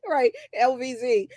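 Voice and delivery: ordinary talk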